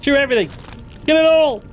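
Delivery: frantic panic. Real, not acted. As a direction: yell in desperate fright. Frantic fight with monster.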